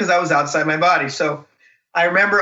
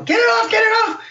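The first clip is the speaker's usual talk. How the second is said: frantic shout at the nurse; pained yelling. Retold or imitated. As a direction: yell it like the cuff is breaking your arm. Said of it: "I start yelling".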